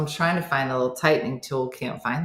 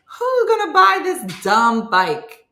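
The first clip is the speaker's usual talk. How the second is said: high, loud, drawn-out incredulity; fed-up comic outburst; exasperated retort; 9 semitones higher, 7 dB louder, slower than usual. Retold or imitated. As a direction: throw it back, slow and loud. Acted retort to Jason's suggestion.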